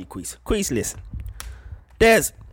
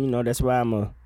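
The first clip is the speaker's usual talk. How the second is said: ordinary talk, nothing marked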